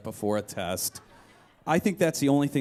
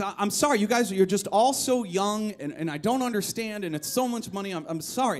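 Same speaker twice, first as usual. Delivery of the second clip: higher, apologetic and slowing; flustered older woman; 6 semitones higher, 3 dB louder than usual. Retold or imitated. Older customer apologizing to the young clerks, higher and flustered. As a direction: stammer like a lost, anxious customer. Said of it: "she says".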